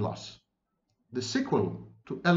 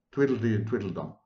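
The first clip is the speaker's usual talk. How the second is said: ordinary delivery, nothing marked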